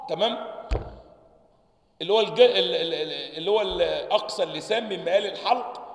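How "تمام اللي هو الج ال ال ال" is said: ordinary talk, nothing marked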